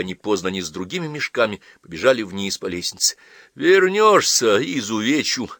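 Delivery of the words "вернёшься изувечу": slow threat, high and loud at first, then dropping; calm threat. Acted. Mother calls after fleeing daughter, calm threat. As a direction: draw out the warning, controlled. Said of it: "раздался вслед спокойный голос".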